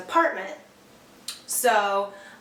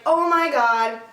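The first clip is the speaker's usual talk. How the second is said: louder, higher dismayed oh my God; horrified at the mess; loud dismay at the puke; shocked gasp; 5 semitones higher, 6 dB louder, slower than usual. Retold or imitated. Sees vomit on floor.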